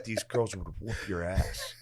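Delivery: a dry, slow dad putdown; lightly voiced dismissive father; imitating dad. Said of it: "And he's like".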